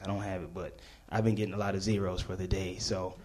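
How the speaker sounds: low, hushed thinking to himself; muttered thought. Retold or imitated. Thinking to himself before he lies.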